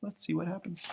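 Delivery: usual speaking voice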